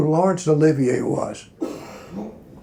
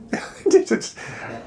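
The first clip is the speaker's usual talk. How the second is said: high breathy laugh; 3 dB quieter than usual. Real, not acted. Searching for a show's name.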